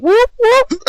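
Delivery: very high, loud whooping ad-lib; high whoop yell. Acted. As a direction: whoop it out like a hype man.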